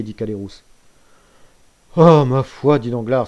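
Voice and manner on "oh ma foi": a loud, offhand brush-off; loud careless "oh, my word"; loud dismissive exclamation. Acted. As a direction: wave it away with a burst. Danglars brushing off blame.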